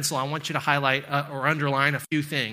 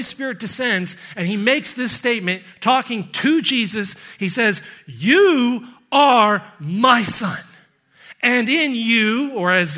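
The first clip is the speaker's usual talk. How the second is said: full-force preaching; 3 semitones higher, 5 dB louder than usual. Acted. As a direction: proclaim God's words with force.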